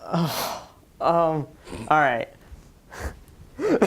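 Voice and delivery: normal talking voice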